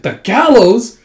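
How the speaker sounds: high, loud acted disbelief; shocked exclamation. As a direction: echo the news in loud shock.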